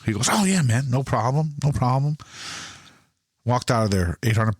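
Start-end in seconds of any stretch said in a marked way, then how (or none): 0.3-2.2 high, easygoing imitation of the manager; breezy salesman. Imitating the manager telling the buyer it's no problem, high and easy. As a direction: wave it off like nothing matters. Manager voiced in retold story.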